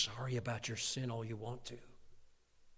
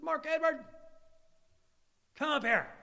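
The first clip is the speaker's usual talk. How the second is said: high, loud imitation of an angry mom; mom's angry summons; 13 semitones higher, 6 dB louder than usual. Mom summons her son in trouble, angry call. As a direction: call out the full name like trouble is coming. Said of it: "you're in trouble when they use your middle name".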